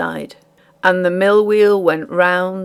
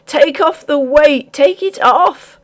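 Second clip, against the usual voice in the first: high, loud, slowing plea to take the weight off; cling to her in panic; the terrified miller begging the housekeeper; terrified cry; 7 semitones higher, 5 dB louder than usual. A narrator's reading. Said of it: "cried the terrified miller".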